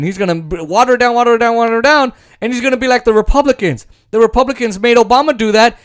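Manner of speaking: usual speaking voice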